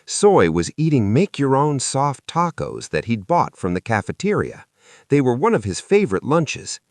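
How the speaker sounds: usual speaking voice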